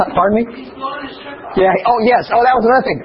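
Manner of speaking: normal talking voice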